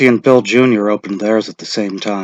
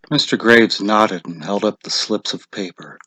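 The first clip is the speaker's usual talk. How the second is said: ordinary delivery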